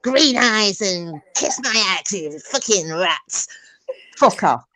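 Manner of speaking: slow, drawn-out mock Philly drawl; hammed-up Philly rant, words stretched; Philly accent. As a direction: drag out the insults in an imitated Philly voice. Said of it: "She talks like that. She's like a Philly".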